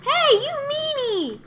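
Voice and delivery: high, loud whiny tease; playful high-pitched scold; mock outrage. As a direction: squeal it like a cartoon kid. Teasing the game characters.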